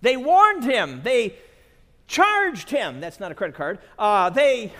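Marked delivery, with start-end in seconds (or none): none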